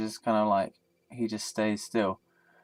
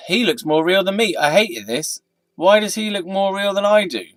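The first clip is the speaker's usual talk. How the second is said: mock outrage; 10 semitones higher, 14 dB louder than usual. Real, not acted.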